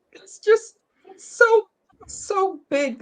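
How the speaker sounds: high, strained words as tears come; pushing through real tears, voice cracking up high; voice breaking. Real, not acted. Mother tearing up to friends over grown children, voice breaking. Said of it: "I'm so sorry for being emotional".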